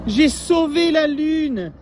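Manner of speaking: high, loud, slow triumphant proclamation; jubilant. Acted. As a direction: stretch it out proudly like a hero. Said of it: "jubila Albert".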